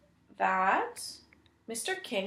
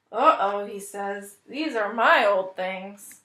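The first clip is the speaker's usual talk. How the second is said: sheepish realization; 8 dB louder than usual. Acted. King realizes his mistake.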